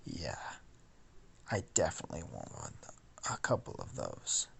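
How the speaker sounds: low, quick hushed whisper. Acted. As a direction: whisper it to yourself, greedy. Narrator echoes Aizawa's line.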